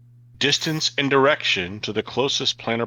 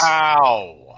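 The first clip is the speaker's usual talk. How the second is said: excited; 8 dB louder than usual. Real, not acted. Surprise at new healing rule.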